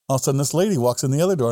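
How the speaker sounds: normal talking voice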